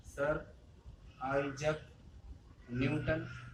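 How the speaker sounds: each word set apart by a pause; word-by-word dictation. Shown as a demonstration. Teacher giving students Newton's name, word by word with pauses. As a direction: spell out the name one word at a time.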